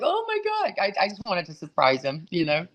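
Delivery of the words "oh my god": shocked. Retold or imitated. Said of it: "they were very shocked".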